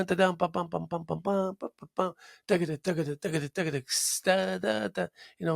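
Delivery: vocalise the drum fills as syllables; drum imitation. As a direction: mouth the drummer's fills.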